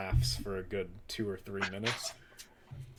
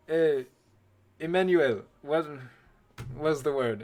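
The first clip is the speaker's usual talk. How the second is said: loud, higher call across the kitchen; chef calling out; 5 semitones higher, 5 dB louder than usual. Acted. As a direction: shout for Emmanuel to help. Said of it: "look around the kitchen and go like".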